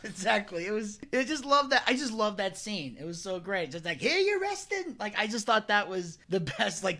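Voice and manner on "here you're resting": high-pitched incredulous wiseguy impression; Joe Pesci. Storyteller replays favorite Pesci line, high incredulous impression. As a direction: squawk it in mock disbelief. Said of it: "you got his, uh, impression down".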